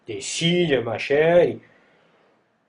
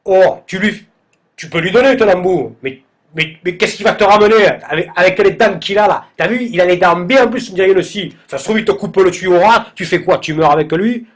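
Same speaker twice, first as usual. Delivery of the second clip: louder and higher, building alarm; rising panic; 6 semitones higher, 6 dB louder than usual. Retold or imitated. Telling friends about the sharp-toothed instructor, in rising panic. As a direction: get more and more worked up as the fear grows.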